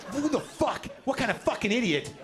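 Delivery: high-pitched, indignant comic rant; hostile outburst. Retold or imitated. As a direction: act out an instant flare of hostility. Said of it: "I was that hostile".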